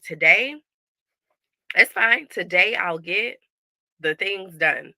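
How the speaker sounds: loud, upbeat self-assurance; breezy confidence. Retold or imitated. Mom telling herself the week is fine, loud and breezy. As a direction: brush it off, sure it will be fine. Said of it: "I was like".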